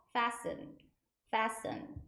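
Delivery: "fasten" said slowly as "fassen"; silent T in FASTEN. Shown as a demonstration. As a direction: model "fasten" with no T sound. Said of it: "T가 발음이 안 나요". ("fasten"의 T는 소리 없이 '패슨'처럼)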